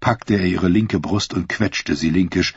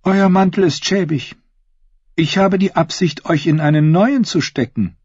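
higher-pitched royal lady; inviting queen; 10 semitones higher than usual. Acted. Queen inviting Sir Osney to sit with her, high inviting voice. Queen promises knight a cloak.

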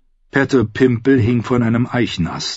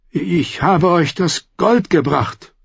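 higher, slow nervous nobleman; trying to sound firm but afraid; a hint of a scared young lord; frightened lord Merritt; 10 semitones higher, slower than usual. Merritt pleading.

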